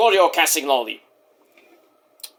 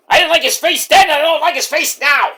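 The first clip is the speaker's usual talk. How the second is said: high, loud, spiteful voice; [name]; 8 semitones higher, 4 dB louder than usual. Pretend. Wrestler grumbling about his rival's face, high angry impression. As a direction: punch out both halves in a raised voice. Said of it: "You did, [name]".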